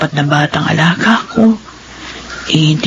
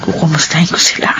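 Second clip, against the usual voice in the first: whispered thought; 2 semitones lower than usual. Acted. Maid's private thoughts at night.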